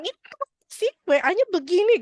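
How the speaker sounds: high, loud, quick annoyed complaint. Acted. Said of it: "kondisi kita lagi kesel aja".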